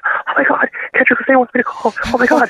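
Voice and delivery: high, breathless squeal of excitement; imitating own giddy, high-pitched fangirling; giddy little girl. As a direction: gush it like a starstruck little girl. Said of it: "jumping up and down like a little girl".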